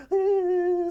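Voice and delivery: loud, very high cartoon whimper; high frightened whine. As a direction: squeal in exaggerated cartoon fear. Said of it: "SpongeBob's chattering his teeth".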